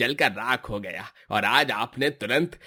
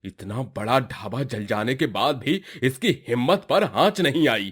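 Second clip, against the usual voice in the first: ordinary delivery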